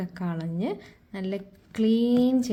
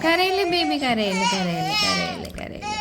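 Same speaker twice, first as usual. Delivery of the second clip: put-on soothing crying baby; 3 semitones higher than usual. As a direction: repeat it, fading down and away.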